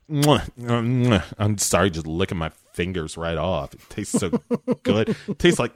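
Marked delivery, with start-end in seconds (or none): 0.1-1.2 smacking kiss noises; kissy sounds. Acted. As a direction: make kissing smacks. Kissing sounds imitated.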